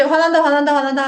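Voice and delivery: playfully agitated high outburst; mock anger. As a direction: act out temper running away. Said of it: "이걸 못하는 사람들".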